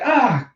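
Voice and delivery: high imitated yelp; sudden high cartoon cry; mock yelp. Sister headbutting the medical team, mock yelp.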